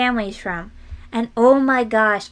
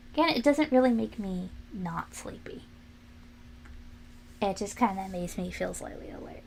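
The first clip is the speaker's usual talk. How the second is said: whispered aside; 3 semitones lower, 9 dB quieter than usual. Real, not acted. Whispered aside about coffee.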